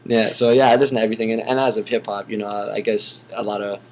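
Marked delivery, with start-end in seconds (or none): 0.0-0.3 laughing. Real, not acted. Laughs after listing bands.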